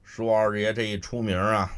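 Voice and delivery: usual speaking voice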